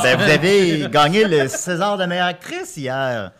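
loud, drawn-out gushing; loud, thrilled welcome; excited congratulations. Real, not acted. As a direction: gush slowly and loudly at a star. Host congratulates celebrity caller.

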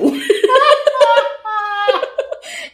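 bursting into loud, high laughter; real laugh cracking up. Real, not acted.

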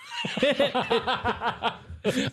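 high-pitched cackling burst; long laugh. Real, not acted. Podcast host cracking up mid-chat, long high laugh.

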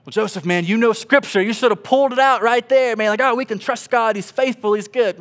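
high, loud scolding of himself; mocking self-reproach. Pastor mocking himself for lacking words, loud and sarcastic. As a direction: chide yourself in a loud, sarcastic preacher voice. Mocking his own inner critic.

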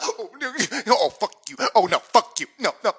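badly faked weeping, cursing through it; imitated crying. Man imitating his partner crying at the movie, mock sobbing. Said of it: "and she's crying".

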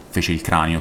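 usual speaking voice

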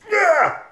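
sudden high yelp; mock scream. Narrator yelping as the alligator attacks.

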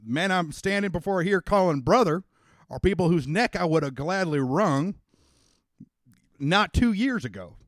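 a lightly voiced Texan father, rousing the crowd; folksy rally speaker. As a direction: speak up like a country politician winning over men. Daddy addresses the rally.

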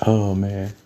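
ordinary delivery

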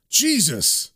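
ordinary delivery

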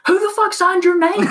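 furious shout. Retold or imitated. Salesman yelling at the lying manager, enraged shout. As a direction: blast the question at him.

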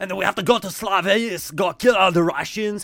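high, loud mock dictator rant; Hitler parody. Friend parodying a dictator for laughs, loud hammed-up ranting. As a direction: yell it in a wandering Austrian-Irish fake accent. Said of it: "if Hitler was like".

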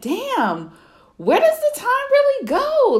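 high, squeaky disbelief on where does the time go; a disbelieving squeal; exasperated disbelief. Retold or imitated. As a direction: rise up high in mock amazement. Exasperated inner quote.

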